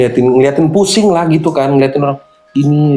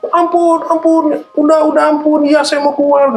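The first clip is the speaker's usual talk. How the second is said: begging spirit voice. Retold or imitated. Possessed woman begging the healer to stop, high wail. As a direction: beg for mercy, sobbing. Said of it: "kata dia".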